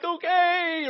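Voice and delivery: high, unconvinced, drawn-out okay; doubtful okay. Acted. Joshua's doubtful reply, acted.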